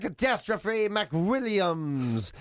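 very slow wrestling-style name call; fighting game announcer. Retold or imitated. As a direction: drag out every word of the name. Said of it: "the weather person would be there going".

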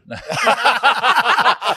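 high-pitched burst of laughter; real high peal of laughter; big laugh. Real, not acted. Joke about the mountain height.

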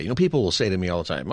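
ordinary talk, nothing marked

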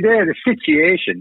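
high Scottish take on SITUATION; bad Scottish accent. Producer on the phone explaining the job, bad Scottish accent. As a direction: imitate a Scotsman saying "situation", pitched high. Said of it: "doing a bad Scottish accent".

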